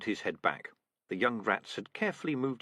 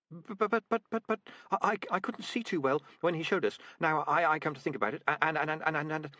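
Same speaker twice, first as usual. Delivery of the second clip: higher, stuttering frightened recruit; stammering nervous rat; 6 semitones higher than usual. Acted. Frightened recruit stammering excuses to her sergeant, higher. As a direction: trip over every word in panic. Nourishing panicking.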